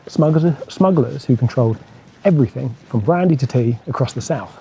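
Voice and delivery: normal talking voice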